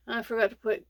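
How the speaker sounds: normal talking voice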